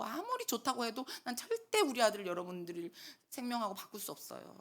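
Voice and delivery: ordinary talk, nothing marked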